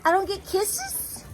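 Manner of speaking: high, slow, soft pleading coo; baby talk. Acted. Teasing the dog for kisses, high baby talk. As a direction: pout at the dog in a babyish voice.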